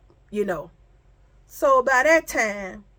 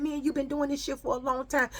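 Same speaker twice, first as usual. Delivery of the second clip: rapid-fire scolding in a raised pitch; retold yelling; 5 semitones higher, 5 dB quieter, much faster than usual. Friend on the phone to the one who told, mock yelling. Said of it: "All I hear is yelling".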